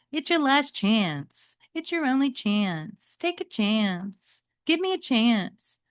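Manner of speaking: drawn-out wheedling Metalhead. Acted. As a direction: stretch each chance out to wear him down. Metalhead pleading, name pun.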